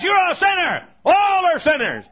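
high, loud hellfire shout; leather-lung preacher shout. Retold or imitated. Imitating a fiery preacher condemning sinners, shouting. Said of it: "some leather-lung preacher stands up and says".

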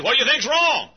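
high-pitched questioning call; puzzled question. Retold or imitated. Car owner asks the supposed mechanic, puzzled high voice.

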